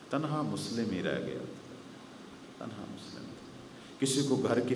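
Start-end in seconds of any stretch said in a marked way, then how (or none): 0.1-2.6 softer and slow, sinking with grief; slow and quiet. Acted. 2.6-3.4 تنہا مسلم تھا breathed out as a whisper. Acted.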